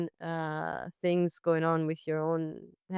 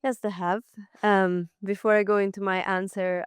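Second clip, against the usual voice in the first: ordinary delivery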